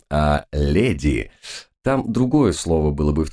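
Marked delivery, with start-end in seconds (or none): 0.1-1.3 wry hesitant naming. Real, not acted. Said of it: "как бы назвать вот этих".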